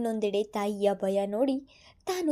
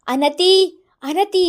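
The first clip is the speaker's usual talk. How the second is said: loud, rising frantic calls; calling out; 8 semitones higher, 11 dB louder, slower than usual. A narrator's reading. Father searching the house, calling loudly. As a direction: call her again and again, higher. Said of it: "ಒಂದೇ ಸಮ ಕೂಗುತ್ತಾ".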